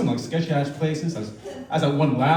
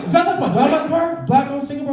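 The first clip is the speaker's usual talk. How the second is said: mock panic; 11 semitones higher, faster than usual. Imagined panic.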